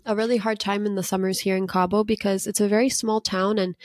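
normal talking voice

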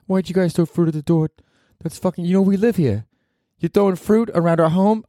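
high, indignant New Yorker; New York accent. Retold or imitated. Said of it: "he was, like, from New York".

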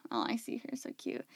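soft unvoiced aside to the cat; whispered coo. Real, not acted. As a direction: murmure attendri. Spots the cat, coos.

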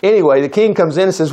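ordinary delivery

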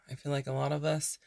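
unhurried meditation voice with long pauses; guided meditation. Real, not acted. Podcast host leading burned-out listeners into breathing, calm meditation voice. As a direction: softly guide them into rest, leaving space to breathe.

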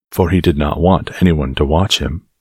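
usual speaking voice

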